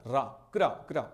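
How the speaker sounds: "रा, क्रा, क्रा" in short separate bursts; sound drilled. Shown as a demonstration. Teacher drills the raa sound, "रा, क्रा, क्रा" said separately. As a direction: say "रा, क्रा, क्रा" with open aa. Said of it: "रा का प्रयोग होता है".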